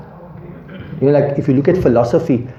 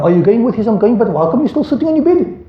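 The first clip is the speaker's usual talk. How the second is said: mock nagging parent; 8 semitones higher than usual. Parent scolding child.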